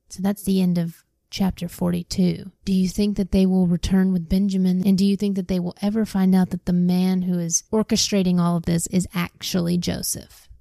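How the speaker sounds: usual speaking voice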